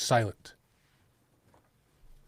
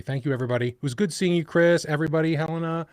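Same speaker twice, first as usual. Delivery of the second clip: ordinary delivery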